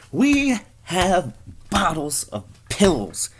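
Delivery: high, slow, drawn-out announcement; ad doctor proclaiming. Acted. Doctor in a commercial, slow high proclamation. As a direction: stretch every word out high.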